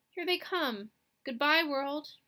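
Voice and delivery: high, loud doomed farewell; dramatic farewell. Acted. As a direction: bid farewell as the monsters enter. Dramatic farewell before doom.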